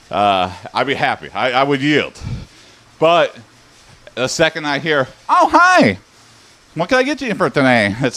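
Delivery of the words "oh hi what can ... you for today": much higher, bubbly sing-song drive-thru greeting; cheery AI greeter. Retold or imitated. Mocking the AI drive-thru greeter, super cheery voice. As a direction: chirp it like an overly perky order bot. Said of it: "the cheeriest voice in the world".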